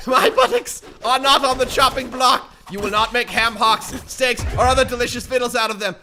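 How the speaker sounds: loud, high hammed-up protest; theatrical defiant yelling; mock indignant shout. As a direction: declaim in exaggerated outrage. Mock speech to attackers.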